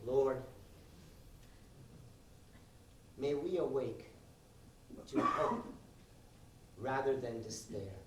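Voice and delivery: soft, hushed mock prayer. Comic leading the crowd in a hushed mock prayer. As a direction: pray softly with fake solemnity.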